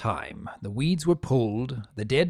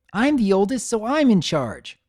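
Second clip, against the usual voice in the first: higher, louder bossy claim; bossy filly; 9 semitones higher, 4 dB louder than usual. A narrator's reading. Older sister insisting on her authority, higher and bossy. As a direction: pull rank on him.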